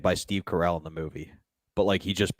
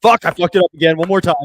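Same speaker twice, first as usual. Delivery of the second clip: high, loud exclamation; exasperated outburst; 10 semitones higher, 11 dB louder than usual. Real, not acted. Co-host botching the dun dun dun, in a loud outburst. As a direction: burst out loudly over a botched take.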